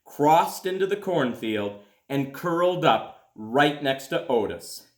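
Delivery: put-on gentle storybook reading for children; calm read-aloud to kids; story narration. A narrator's reading. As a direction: read it like a bedtime picture book. Reads a picture book aloud.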